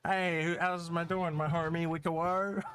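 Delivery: high, put-on buddy voice; silly greeting voice. Host pretending to greet a homie, high silly voice. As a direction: hail a pal in a high goofy voice, name stretched.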